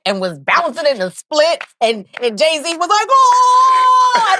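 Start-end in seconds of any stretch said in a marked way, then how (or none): 3.1-4.4 high, loud "oh" of star-struck thrill; retold party scream. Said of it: "I'm screaming".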